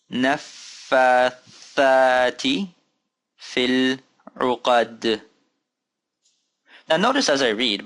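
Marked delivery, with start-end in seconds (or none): 0.1-5.2 long held syllables, wide pauses; slow careful recitation. Shown as a demonstration. Teacher asking learners to follow along, slow and spaced. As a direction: read it slowly so others can follow.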